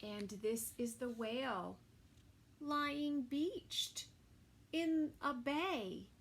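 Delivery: put-on lively storybook reading for kids; reading to children. A narrator's reading. Narrator reading a story to children, lively. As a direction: read it like a bedtime picture book. Reading picture book aloud.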